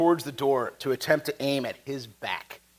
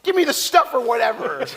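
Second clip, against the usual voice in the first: impatient bluffing; 11 semitones higher, 6 dB louder, slower than usual. Acted. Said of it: "He's like".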